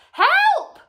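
very high, loud cry for help; screamed. A narrator's reading. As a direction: scream for rescue in panic. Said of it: "screamed Missus Twit".

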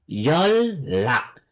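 "yolak" slowly with a held double L; correct assimilated pronunciation. Shown as a demonstration. Korean teacher explaining the rule, assimilated pronunciation. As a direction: draw out "yolak" as yol-lak. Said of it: "tamang pronunciation".

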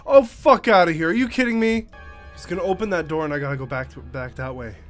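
ordinary delivery

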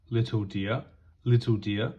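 ordinary delivery, nothing marked

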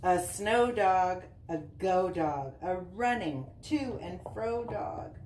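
bouncy sing-song picture-book reading; reading to children. Acted. Mom reading a dog book to Easton, sing-song read-aloud. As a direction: read the rhymes with a lilt for little kids.

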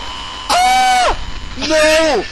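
high, loud terrified yelling; shouting no while fleeing Slender; panicked shout. Real, not acted. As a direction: yell in pure panic. Fleeing the monster.

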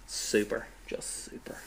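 sarcastic super. Acted. Deadpan reply to greeting.

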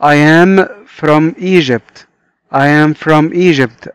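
very slow, each word separated: I am from Egypt; slow dictation pace for learners; slow model reading. Shown as a demonstration. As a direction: read it slowly like a teacher modelling English. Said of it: "صديقنا ده بيقول لنا".

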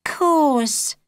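put-on high, brief girl's reply; young girl's voice. A narrator's reading. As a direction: answer short like a girl swallowing disappointment. Holly hiding disappointment.

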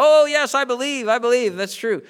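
high, loud eager cheering; eager crowd. Retold or imitated. Crowd answering the tightrope walker, high and loud eager agreement. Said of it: "And everybody".

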